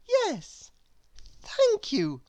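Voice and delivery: high, eager acceptance; high eager yes. A narrator's reading. Little Miss Wise remembering her decision, accepting high and eager. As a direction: switch to a bright high yes. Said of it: "she cried".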